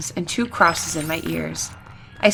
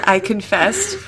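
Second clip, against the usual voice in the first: plain reading voice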